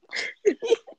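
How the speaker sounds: squealing laugh; high burst of laughter. Real, not acted. After nose story.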